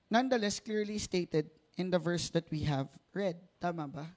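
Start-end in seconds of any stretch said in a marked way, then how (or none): none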